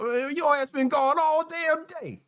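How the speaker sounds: host imitating an owner scolding his dog, mock scolding. Mock scolding. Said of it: "Make you cuss them the fuck out".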